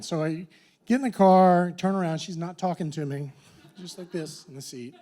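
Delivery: usual speaking voice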